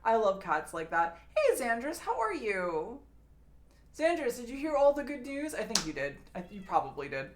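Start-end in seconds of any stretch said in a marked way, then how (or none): 1.4-5.5 sweet pet greeting. Real, not acted.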